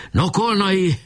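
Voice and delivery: high-pitched summons; shout. A narrator's reading. Leader calling his trumpeter to action, shouting. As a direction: call out to a comrade by name. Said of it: "kiáltott Boka".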